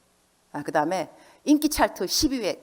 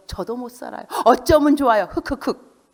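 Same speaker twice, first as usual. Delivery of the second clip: mock weeping leader; 6 semitones higher, 5 dB louder than usual. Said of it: "이런 리더".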